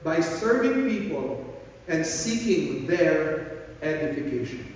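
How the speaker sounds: slow, each word laid down; slow emphatic repeat. Shown as a demonstration. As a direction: repeat it slowly so it sinks in. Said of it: "This is the first way". (dahan-dahang inuulit para idiin)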